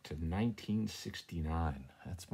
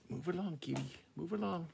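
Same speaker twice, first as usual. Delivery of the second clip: coaxing the cat; 9 semitones higher, faster than usual. Real, not acted. Shooing the cat.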